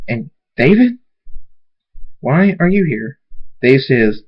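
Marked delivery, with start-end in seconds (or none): 0.1-3.2 high, surprised demand; startled questioning. Acted. Thunderstorm caught kissing by David, startled high questioning. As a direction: pitch jumps up in disbelief.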